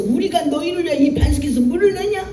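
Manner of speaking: high, hot-tempered shout; 뚜껑이 열린 듯 화난 외침; lid blown off. Retold or imitated. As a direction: lash out at the crowd in a temper. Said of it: "너무너무 뚜껑이 열려가지고".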